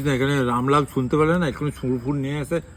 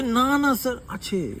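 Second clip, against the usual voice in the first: much higher, eager polite reply; imitating staff; 12 semitones higher, slower than usual. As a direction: squeak it out like a keen clerk. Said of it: "বলছে".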